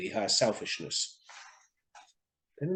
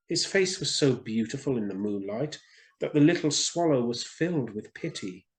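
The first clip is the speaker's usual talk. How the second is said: ordinary delivery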